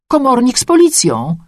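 high-pitched neighbour breaking the news; excited, high-voiced announcement; excited report. A narrator's reading. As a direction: announce it eagerly, pitched well up.

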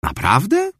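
high, rising surprise; a lifted, surprised question. Acted. Mother surprised by Bella's news, high and rising. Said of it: "zdziwiła się mama".

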